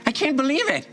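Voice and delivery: high, laughing gnome dad; high, giddy father voice with a laugh; overjoyed gnome dad. Acted. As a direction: laugh through the joy of her return. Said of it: "And he goes".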